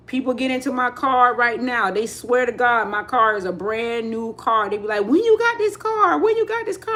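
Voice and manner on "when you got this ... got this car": high-pitched mock astonishment; imitated amazed passengers. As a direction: play friends who can't believe the car. Passengers amazed at her car.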